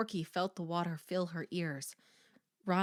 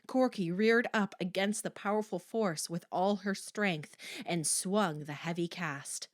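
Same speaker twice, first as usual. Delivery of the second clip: ordinary delivery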